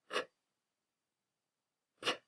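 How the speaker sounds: k, made at the back of the throat; hard k, lips barely moving; crisp isolated k sound. Shown as a demonstration. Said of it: "at the back of your throat".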